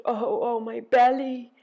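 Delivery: loud, high groan. A narrator's reading. Ereshkigal groaning over her belly, loud high groan. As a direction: groan it out, swelling on the last word. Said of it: "She groaned".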